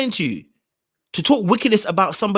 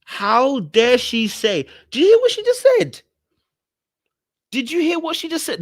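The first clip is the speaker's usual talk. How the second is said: high-pitched scandalised disbelief; appalled, pitch leaping up; theatrical outrage; 8 semitones higher than usual. Acted. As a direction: squeal it in mock indignation. Outraged reaction to the clip.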